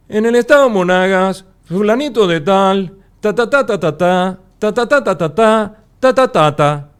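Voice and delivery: high, loud, choppy sing-song news read; mock regional correspondent chopping every phrase; staccato reporter delivery. Shown as a demonstration. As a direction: demonstrate the staccato newscaster habit, each beat hit. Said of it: "el golpe de staccato viene".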